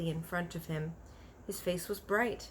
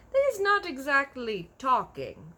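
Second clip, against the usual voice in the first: giant's voice; 8 semitones higher, 8 dB louder than usual. A narrator's reading. BFG voiced in a story.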